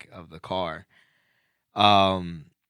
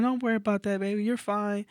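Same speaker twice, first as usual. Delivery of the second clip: sweet lady's voice; 14 semitones higher, faster than usual. Retold or imitated. Homeowner reassures the late pizza driver, high and kind.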